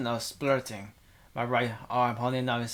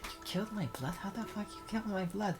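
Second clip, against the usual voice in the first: higher, softer imitation of the girl's outrage; mock squeaky outrage; 7 semitones higher, 8 dB quieter, faster than usual. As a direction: parody her shock, thin and high. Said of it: "you're just like".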